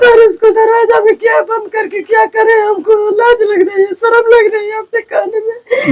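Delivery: high, tearful voice with a broken laugh; forcing it out through tears; crying in shame. Real, not acted. Woman ashamed to tell reporter, sobbing high.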